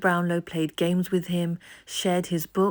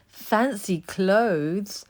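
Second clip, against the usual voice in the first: laughing; 4 semitones higher, 3 dB louder, much slower than usual. A narrator's reading. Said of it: "laughed Dodger".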